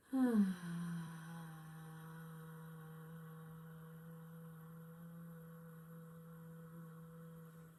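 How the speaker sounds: very long, sustained "ahh" on the out-breath; sighed "ahh". Shown as a demonstration. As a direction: sigh out a steady, drawn-out "ahh". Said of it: "make the sound A H".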